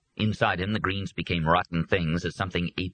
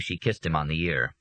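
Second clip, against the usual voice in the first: ordinary delivery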